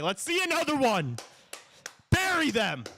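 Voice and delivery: high, fired-up cheer; high-pitched rallying call; excited urging. Real, not acted. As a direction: egg the team on. Urging team on power play.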